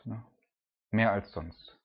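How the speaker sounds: normal talking voice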